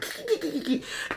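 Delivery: high, very soft mock giggle; muffled snickering from behind the camera; imitated stifled chuckling. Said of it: "chuckling behind the camera".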